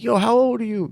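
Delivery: put-on high-pitched curious kid; mock kid's question; imitated kid. Imitating kids asking his age.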